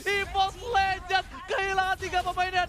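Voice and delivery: loud, high shouting call; live play-by-play shout. Acted. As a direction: scream the comeback to the crowd. [name] calls the decisive fight.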